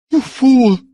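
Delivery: high and loud, beside herself; furious scream. A narrator's reading. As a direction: scream it at him in fury. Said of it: "screamed Balessa almost beside herself".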